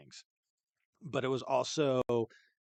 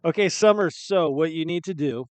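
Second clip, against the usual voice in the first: louder, higher winner announcement; excited announcement; 6 semitones higher, 12 dB louder, slower than usual. Real, not acted.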